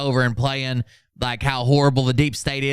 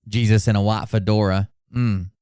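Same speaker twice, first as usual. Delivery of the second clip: usual speaking voice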